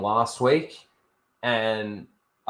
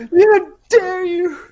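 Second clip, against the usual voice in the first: high, slow, hammed-up wail at the tree; mock grief-stricken rage; 12 dB louder, slower than usual. Imagined friend yelling at a tree over his lost pal, hammed-up wail. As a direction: ham up a betrayed friend's fury, much higher.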